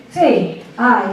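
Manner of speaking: ordinary delivery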